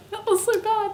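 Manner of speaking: high, loud, through real laughter; laughing embarrassment. Real, not acted. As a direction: crack up at your own bad attempt. Laughing at own failed accent.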